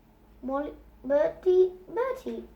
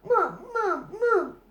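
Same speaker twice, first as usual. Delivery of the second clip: put-on coaxing baby talk; 7 semitones higher than usual. Mother coaxing baby to speak.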